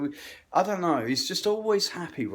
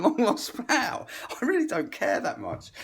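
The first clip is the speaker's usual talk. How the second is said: ordinary talk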